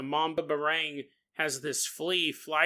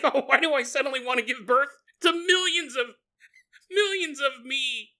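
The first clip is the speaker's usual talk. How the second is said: alarmed victim; 7 semitones higher than usual. Acted. Victim feels spider spell, quoted.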